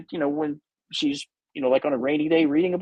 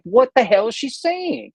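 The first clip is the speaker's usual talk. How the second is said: baffled; 7 semitones higher, 4 dB louder than usual. Retold or imitated. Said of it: "I'm thinking, like".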